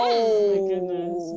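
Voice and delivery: loud drawn-out gasp of shock. Real, not acted. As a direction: stretch it out in shock. Shocked reaction, loud slow.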